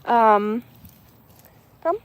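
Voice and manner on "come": normal talking voice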